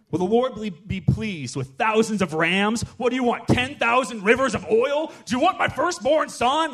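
climbing, desperate offers; panicked people offering anything; frantic pleading. Acted.

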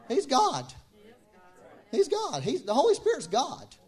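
loud, high insistence on "He's God"; emphatic proclaiming. Acted. Preacher insisting to the church the Spirit is God. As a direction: hammer home that He's God.

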